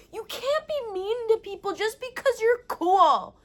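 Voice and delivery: a little sister scolds her brother loudly; scolding little girl. Retold or imitated. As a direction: lecture him like a tiny teacher. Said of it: "she goes".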